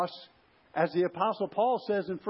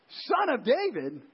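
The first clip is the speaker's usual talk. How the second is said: higher, drawn-out call, not shouted; mild retold cry; 6 semitones higher than usual. Preacher retelling the blind man's call to Jesus, mild cry. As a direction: imitate a plea called out slowly. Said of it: "when this man cries out".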